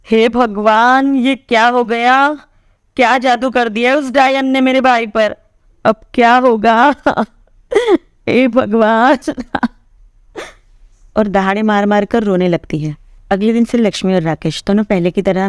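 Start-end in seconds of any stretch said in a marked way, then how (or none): 0.1-9.2 slow, distraught moaning to herself; despairing lament. A narrator's reading. Sister alone, despairing over her brother, slow and distraught. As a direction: lament slowly, near tears. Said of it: "खुद से ही बड़बड़ाने लगती है".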